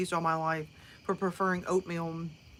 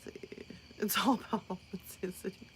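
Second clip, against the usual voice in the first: whispering; 8 dB quieter than usual. Real, not acted. Aside, drops to a whisper.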